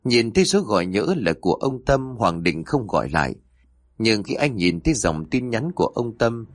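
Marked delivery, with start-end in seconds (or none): none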